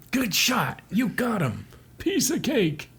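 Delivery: high-pitched, punchy congratulations; high cheering. Acted. Comrades cheering Hardy's shot, high mock cheer.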